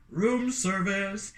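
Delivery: slow, drawn-out call at the door; hotel staff voice. Acted. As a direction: announce it slowly through the door. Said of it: "and the voice said".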